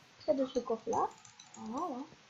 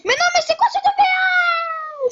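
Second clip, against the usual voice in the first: high, loud, slow laughing yell of disbelief; laughing shout; 10 dB louder than usual. Real, not acted. Gamer yelling in disbelief at an opponent's move, laughing. Real outburst at game moment.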